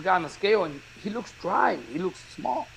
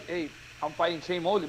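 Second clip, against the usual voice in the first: usual speaking voice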